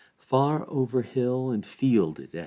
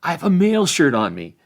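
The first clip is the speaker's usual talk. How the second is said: cheery hobbit calling out; 10 semitones higher, 10 dB louder than usual. A narrator's reading. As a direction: warn Nibs off with a joke. Sam calls to Nibs with hay fork.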